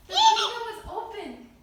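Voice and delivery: loud laughing outburst; laughing shout. Real, not acted. Bird misses open window.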